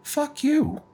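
high, soft little voice; very soft, deferring. Acted. Employee answering her angry boss, very soft and deferential. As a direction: say it sweetly hushed, as if deferring. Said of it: "in a very soft voice, almost like she's deferring".

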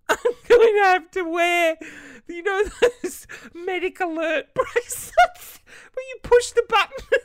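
hammed-up despair, crying through a laugh; dramatic mock crying. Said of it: "so dramatic".

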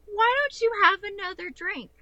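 mocking sweet offer. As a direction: needle him with fake sweetness. Mocking her friend, offering drinks.

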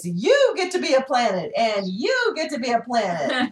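big announcer-style giveaway, swooping up on each "you"; hammed-up talk show host handing out prizes; Oprah impression. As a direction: punch and stretch each YOU, grandly. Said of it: "With an Oprah voice".

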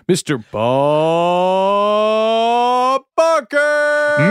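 booming, drawn-out announcer call; game show announcer. Shown as a demonstration. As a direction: stretch the name out like a host. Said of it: "in your game show announcer voice".

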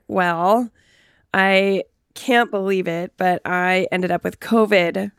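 ordinary delivery

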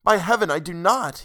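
high, emphatic bewilderment; baffled lover's earnest protest; troubled, amazed. A narrator's reading. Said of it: "he said, troubled and amazed".

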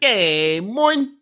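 bad, high English greeting; English accent. Joking with his co-host, bad English accent. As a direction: call out in clumsy English accent. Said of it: "my English accent is always".

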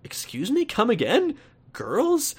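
high-pitched, disbelieving questions; mock-puzzled, rising sharply on each query; incredulous reaction. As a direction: jump up in pitch as a baffled listener. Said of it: "And you're like".

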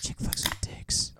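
breathy whispered title. Pretend. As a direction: whisper the show name.

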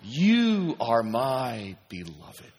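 very slow, weighty; a preacher letting the words land; slow affirmation. Shown as a demonstration. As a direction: stretch each word out. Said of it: "slow repeated affirmation".

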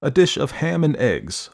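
plain reading voice

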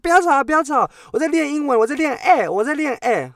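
high, hammed-up defensive reply; mock husband reply. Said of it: "你说".

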